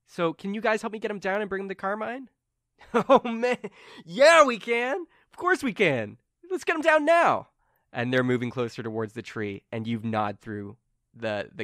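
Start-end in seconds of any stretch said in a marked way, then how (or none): 0.1-2.3 high, quick eager request; Carmen asking help. Acted. Carmen asks companions to help get him down, eager. 2.9-7.5 high, loud gung-ho henchman; eager agreement. Acted. Limbo members agree to help Carmen, excited.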